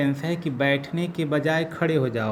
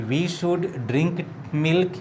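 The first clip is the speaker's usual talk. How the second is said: slow dictation; 3 semitones higher, much slower than usual. Shown as a demonstration. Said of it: "ये हो गया".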